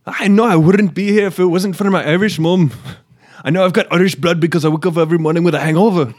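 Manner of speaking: loud, high hammed-up Irish accent; attempted Irish accent. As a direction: belt it out in a shaky-skilled Irish brogue. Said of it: "I think I'd need more high pitch in it".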